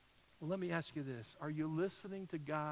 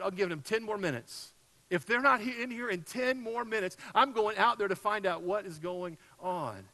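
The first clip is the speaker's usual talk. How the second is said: impatient ultimatum; 5 semitones higher, 8 dB louder than usual. Retold or imitated. Impatient ultimatum in waiting room.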